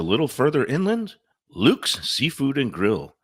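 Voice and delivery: upbeat radio ad read. Acted. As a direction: sell it like a radio spot.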